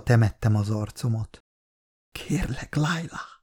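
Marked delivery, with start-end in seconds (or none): 2.1-3.3 high, very soft whispered plea. A narrator's reading. Narrator begging the dying loved one to stay, whispered. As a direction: plead under your breath. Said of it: "súgtam".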